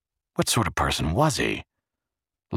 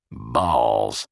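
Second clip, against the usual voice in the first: lower, sour dismissal; 6 semitones lower, 3 dB louder than usual. A narrator's reading. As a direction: spit it out, fed up. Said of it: "Luckman said sourly".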